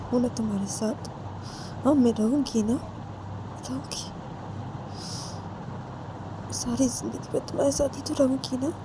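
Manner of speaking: hushed, tender coaxing, whispered at first; soothing. Acted. Uma wiping Sanju's tears, promising to stay, soothing. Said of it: "आंखों से आंसू को साफ करती है".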